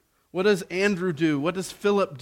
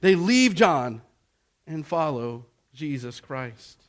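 high, loud preacher's build; impassioned pulpit delivery; fiery preaching; 5 semitones higher, 6 dB louder than usual. Acted. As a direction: preach it out to the hall. Preaching at full force.

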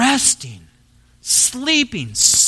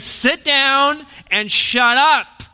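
slow, higher, punched rebuke; forceful command; 8 semitones higher, 3 dB louder, much slower than usual. Retold or imitated. Jesus silencing the wind and waves, forceful and slow. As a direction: order the storm to sit down, hard. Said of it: "Jesus is being very forceful here".

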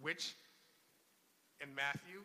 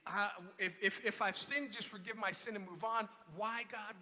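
louder, higher desperate plea to God; Job's rising complaint, louder and higher; pleading cry; 4 semitones higher, 6 dB louder than usual. Retold or imitated. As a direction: beg God for an answer. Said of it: "And he goes on".

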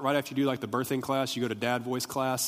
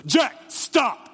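booming, high sharp shout; dad voice; 11 semitones higher, 8 dB louder than usual. Shown as a demonstration. Dad to his son walking into the street, booming shout. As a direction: boom it out to halt a child instantly. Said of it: "this is what it sounds like".

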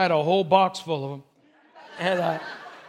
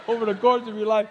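louder imitation of a German accent; 5 dB louder than usual. Dad consoling his lovesick son, in a German accent. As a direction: put on dad's German accent. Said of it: "he said in his German accent".

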